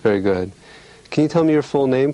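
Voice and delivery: normal talking voice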